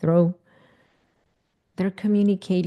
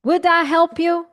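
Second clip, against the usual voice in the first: high, loud, doubting; would that really help you; much higher, disbelieving ask; incredulous question; 9 semitones higher, 4 dB louder than usual. Real, not acted. Mocking unhelpful answer.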